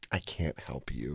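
soft, low, slow customer-service voice; fake-polite refusal. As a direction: imitate rude politeness, drawn out and hushed. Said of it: "someone's rude to you while smiling".

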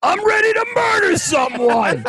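hammed-up rage that climbs to a shout; mock building rant. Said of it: "And it always builds too".

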